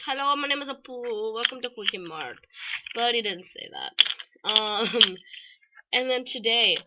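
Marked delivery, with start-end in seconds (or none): 0.0-2.4 mock Indian accent, cartoon shopkeeper; hammed-up Indian accent; Apu. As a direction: greet customers in a put-on Indian accent. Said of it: "wanted him to be like".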